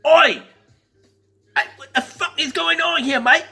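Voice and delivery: imitating an affronted British bloke; John Soyega, British accent. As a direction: bristle in a put-on British accent. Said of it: "and he was like".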